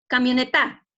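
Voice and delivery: "camioneta" loud, ending on a punched TA; wrong stress on TA. Shown as a demonstration. Teacher testing the wrong syllable, stress on TA in "camioneta". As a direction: push the final TA of "camioneta". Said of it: "la sílaba tónica está en ta".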